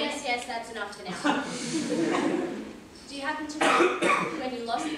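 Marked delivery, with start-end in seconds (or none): 1.5-3.0 burst of laughter. Acted. Laughs during questioning.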